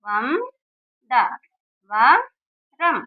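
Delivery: వం, ద, వ, రం spaced apart, each syllable alone; spelling out syllables. Shown as a demonstration. Teacher sounding out syllables for pupils, slow and separate. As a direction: sound out వం, ద, వ, రం one by one. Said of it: "అలా చదువుకోవాలి".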